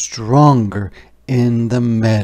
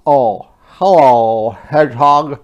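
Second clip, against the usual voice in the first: otter voice; 2 semitones higher, 10 dB louder than usual. Acted. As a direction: huff it out like a cold otter. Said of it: "replied Otter".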